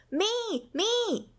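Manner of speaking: high, excited little squeak of a cry; eager cry. A narrator's reading. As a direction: bounce up wanting to be picked. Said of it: "cried Pip".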